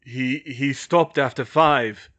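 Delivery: slow, loud, faltering reply; pained and scared. A narrator's reading. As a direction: stammer the news out slowly, shaken. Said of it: "Harry sounded pained and scared himself".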